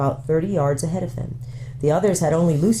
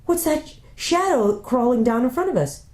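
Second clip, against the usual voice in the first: put-on higher, eager boy asking; excited question; 8 semitones higher than usual. Boy asking his sister about the shadow, excited. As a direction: point it out with excitement. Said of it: "in great excitement".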